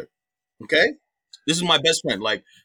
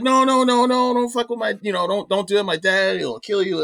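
rapid-fire no's, higher pitched; imitated frantic pleading; 7 semitones higher than usual. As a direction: mimic a girlfriend begging him to stop. Voices girlfriend pleading against a fight.

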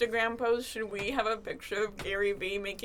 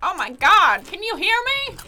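excited shouting; 9 semitones higher, 10 dB louder than usual. Pretend. Pretend first phone call.

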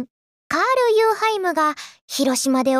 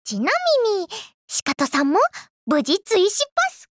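ordinary talk, nothing marked

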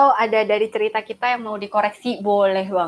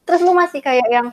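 ordinary talk, nothing marked

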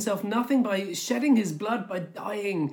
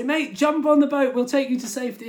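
loud, higher call across floodwater; 8 semitones higher, 6 dB louder than usual. Retold or imitated. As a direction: shout it over the water to the stranded man. Rescuers calling out from boat.

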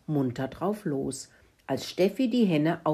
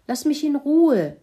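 loud, high-pitched bellow; angry bellow; 10 semitones higher, 8 dB louder than usual. Acted. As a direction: bellow it like a grumpy pig woken up. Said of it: "brüllte Kalle".

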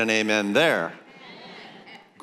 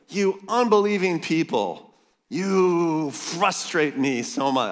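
high then drawn-out slow exasperation; mock-frustrated Jesus; 7 semitones higher, slower than usual. Preacher paraphrasing Jesus to his congregation, mock frustrated. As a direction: paraphrase Jesus, fed up and playful. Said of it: "I love this Jesus".

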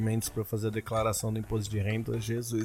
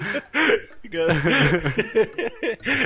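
laugh; 9 semitones higher, 3 dB louder than usual. Real, not acted. Laughing at the review.

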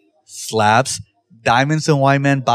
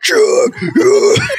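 high, loud screamed name; screaming warm-up; 3 dB louder, much slower than usual. Retold or imitated. Singer warming up outside.

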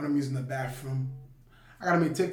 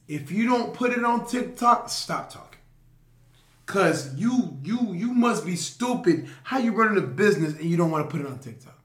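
hype speech; 2 semitones higher, 5 dB louder than usual. Acted. Loud hype advice continues.

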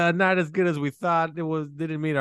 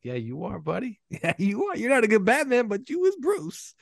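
mock reply; 8 semitones higher than usual. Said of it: "I was like".

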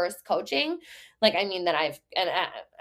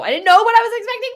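high, fast and louder; excited rush; 13 semitones higher, 9 dB louder, much faster than usual. Real, not acted. Coach recalling her shock at the price, excited and fast.

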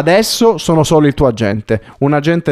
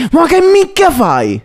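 angry; 13 semitones higher, slower than usual. Acted. Repeat, higher and slower.

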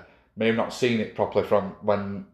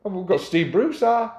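put-on Geordie accent; 9 semitones higher than usual. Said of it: "Well, let's hear it then".

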